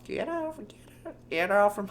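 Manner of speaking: put-on very high old person squeak; testing a falsetto old voice; high, tentative old person. Shown as a demonstration. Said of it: "how do I do an old person voice?".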